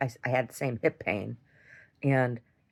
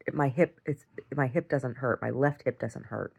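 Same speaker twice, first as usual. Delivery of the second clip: ordinary delivery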